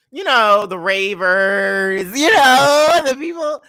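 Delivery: high, loud rising squeal on "you know"; excited squeal. Real, not acted. Host gushing to chat about the raver crowd, squealing.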